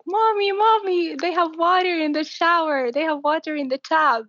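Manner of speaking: very high, imitated little-girl excitement; excited child. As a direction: call to mommy like a thrilled five-year-old. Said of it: "she was very excited".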